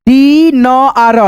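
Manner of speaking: "डी... न... आर" very slowly, long dee first; broken into sounds. Shown as a demonstration. Teacher sounds out dinner for learners, very slowly. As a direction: stretch "डी न आर" into three parts. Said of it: "इसे क्या पढ़ेंगे".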